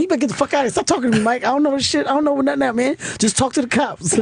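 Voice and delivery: mock-exasperated high-pitched rant; irritated retort. Friend snapping at driver in story.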